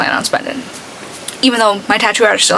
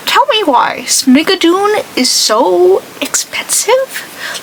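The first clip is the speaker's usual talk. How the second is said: slow, higher disbelieving complaint; exasperated; 7 semitones higher, much slower than usual. Real, not acted. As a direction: drag it out in disbelief. Complaining about ticket prices.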